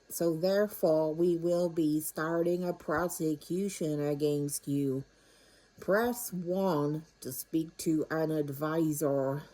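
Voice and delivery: slow automated phone announcement, evenly spoken; deliberately slow mock robocall; recorded scam message. Scammer's recording claiming to be the tax office, slow announcement. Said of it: "well, it's a recorded message".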